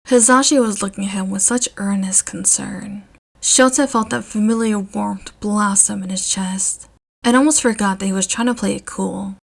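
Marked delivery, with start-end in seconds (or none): none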